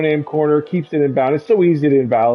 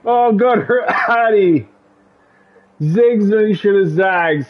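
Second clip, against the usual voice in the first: high, slow shout breaking into a laugh; excited shout; 5 semitones higher than usual. Real, not acted. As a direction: yell it out, laughing at the crash. Crash seen, commentator erupts.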